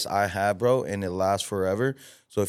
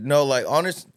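ordinary talk

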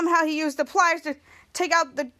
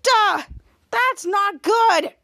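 higher, louder, drawn-out protest; dismayed outburst; 4 semitones higher, 5 dB louder, much slower than usual. Retold or imitated. Captain reacting as things go wrong, dismayed. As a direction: act out alarmed dismay.

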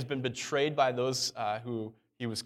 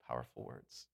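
"Powerful words." repeated much lower and much softer, almost under the breath; hushed echo; 12 semitones lower, 16 dB quieter than usual. Shown as a demonstration. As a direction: drop the voice right down and let "Powerful words." echo quietly. Preacher echoes phrase, dropping voice.

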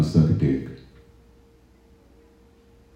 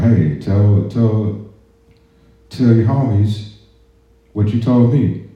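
drill sergeant; 6 semitones higher, slower than usual. Retold or imitated. Drill sergeant barking at recruit.

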